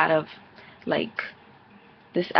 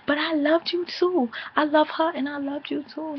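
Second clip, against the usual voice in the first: much higher mock cry; imitating Erica yelling; 9 semitones higher than usual. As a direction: play the tearful lover, pitched way up. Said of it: "yelling at Rich".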